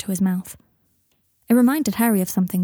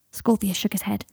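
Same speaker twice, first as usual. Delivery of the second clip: usual speaking voice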